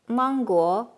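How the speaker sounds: normal talking voice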